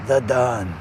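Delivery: low mafia-boss voice; Italian mobster. Acted. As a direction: do a mock Italian mob don. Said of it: "an Italian mobster".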